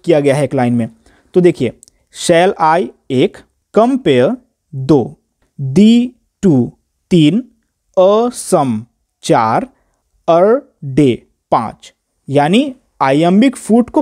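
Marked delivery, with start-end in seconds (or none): 2.2-11.9 slow, syllable-by-syllable reading of the stresses; scanning the line. Shown as a demonstration. Teacher counting the iambic feet for students, slow and deliberate scansion. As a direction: read it very slowly, marking each stressed beat. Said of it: "unstress, stress use करते हैं".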